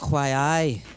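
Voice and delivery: accidental Geordie for Scottish; Geordie accent. Pretend. Aiming for Scottish while playing, slipping into Geordie. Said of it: "that's Geordie".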